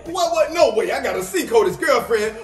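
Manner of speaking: normal talking voice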